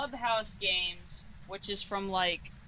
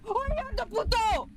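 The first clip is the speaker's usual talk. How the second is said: excited shout; 13 semitones higher, 6 dB louder than usual. Real, not acted. Cursing at the ghost.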